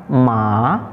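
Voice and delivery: second-tone "Ma", lifted at the end; rising tone. Shown as a demonstration. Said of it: "dibaca naik dari bawah ke atas".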